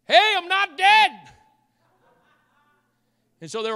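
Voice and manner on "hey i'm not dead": high, loud comic yell; mock alarmed shout. Girl pronounced dead calling from back room, mock shout. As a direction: yell it out suddenly in a put-on high voice.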